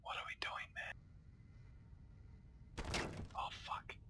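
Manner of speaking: hushed unvoiced whisper. Real, not acted. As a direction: whisper so nobody hears. Sneaking in game, whispering.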